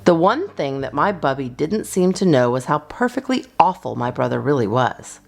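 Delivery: usual speaking voice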